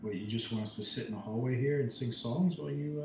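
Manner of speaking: ordinary delivery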